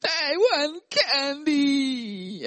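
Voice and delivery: put-on high, slow whining kid; whiny child. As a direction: whine it like a toddler wanting candy.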